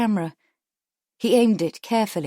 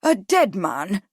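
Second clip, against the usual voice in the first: crying out; 3 dB louder, slower than usual. A narrator's reading. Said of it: "Trunky Thomas cried".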